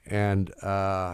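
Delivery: ordinary delivery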